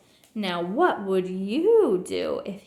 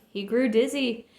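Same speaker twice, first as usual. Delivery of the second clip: plain reading voice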